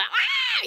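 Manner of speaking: short, high piercing mock scream. Mother imitating her screaming toddler son, high piercing shriek. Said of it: "piercing, loud scream".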